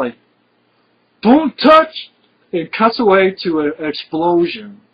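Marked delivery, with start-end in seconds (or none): 1.2-2.1 high, loud panicked yell imitating Arnold; Arnold's shouted warning. Action hero yelling at a clumsy cop, loud high imitation. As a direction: bark it out like an action hero stopping a rookie. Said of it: "I just love Arnold's reaction".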